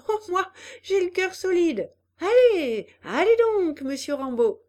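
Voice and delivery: plain reading voice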